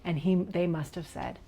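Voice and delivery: normal talking voice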